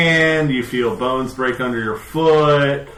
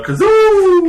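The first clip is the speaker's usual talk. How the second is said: high, loud name call; excited call; 11 dB louder than usual. Acted. Calls the familiar's name.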